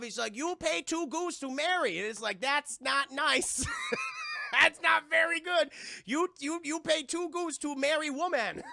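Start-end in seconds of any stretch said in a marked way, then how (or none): 0.4-2.0 put-on caricatured Chinese-accented English; mock Chinese accent. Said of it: "He talked in broken English". 2.4-5.7 high, loud words breaking into laughter; helpless laugh, drawn-out words; laughing protest. Real, not acted. Laughing at the offensive line. 6.0-8.6 mock Chinese accent, higher pitched; Charlie. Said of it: "He talked in broken English".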